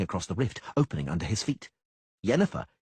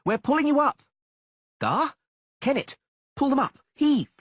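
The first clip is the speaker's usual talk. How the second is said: very high, loud commanding calls; rally the haulers; rescue shouts; 6 dB louder than usual. Acted. Dandelion orders the men to haul them up, loud high shouts.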